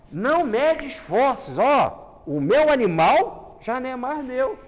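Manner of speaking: high, loud joyous declaration; generous Samaritan. Acted. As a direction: give everything away gladly.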